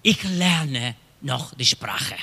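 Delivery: ordinary delivery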